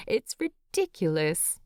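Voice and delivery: ordinary delivery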